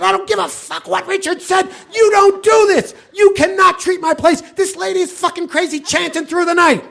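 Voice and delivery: high-pitched furious shouting rant; furious groundskeeper. Retold or imitated. Groundskeeper shouting at the nuns in a high, furious voice. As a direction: yell it like he's losing his mind.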